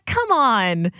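normal talking voice

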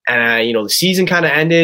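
ordinary talk

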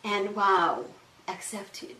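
ordinary delivery

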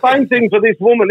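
normal talking voice

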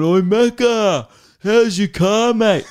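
high, loud, stretched-out mock holler; teasing call-out. Imitating workmates calling to oblivious Macca, loud teasing shout. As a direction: sing out across the work site to wind him up.

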